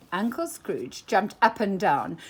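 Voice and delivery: usual speaking voice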